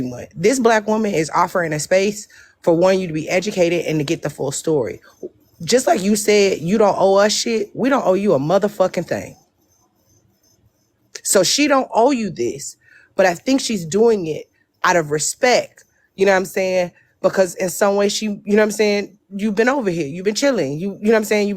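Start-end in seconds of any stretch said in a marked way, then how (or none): none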